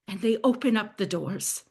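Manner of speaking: ordinary talk, nothing marked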